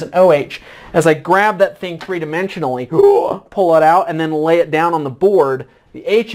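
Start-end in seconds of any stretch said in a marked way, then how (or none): 2.9-3.4 quick high theatrical gasp; short mock gasp for effect; sharp gasp. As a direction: gasp as if startled mid-demo. Dramatic gasp while demonstrating the flip.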